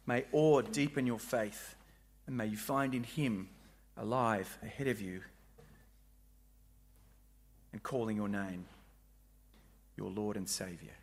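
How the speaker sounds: soft, low closing blessing; benediction. Acted. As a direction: bless them gently as you close. Closing benediction over music.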